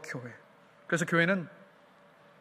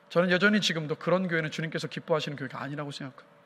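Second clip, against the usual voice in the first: ordinary talk, nothing marked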